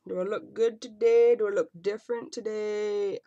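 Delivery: lightly hammed-up vanity; mock vain self-check. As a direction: tease someone checking the mirror. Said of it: "I'm not one that's really like".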